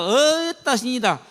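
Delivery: Goliath jeering at the troops; mocking jeer. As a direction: taunt them like a bully. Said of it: "이렇게 놀리고 있다".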